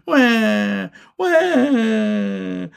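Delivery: high, long bawl; little one's cartoon wail; crying wail. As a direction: burst into childish "wah" crying. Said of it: "Benny began to cry".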